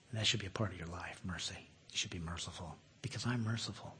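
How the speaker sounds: hushed voice of God. Acted. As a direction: speak mercy gently as God. God speaking mercy to listeners.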